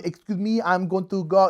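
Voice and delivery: put-on French-accented English; heavy mock French accent. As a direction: imitate a French woman speaking English. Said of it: "this is my French accent".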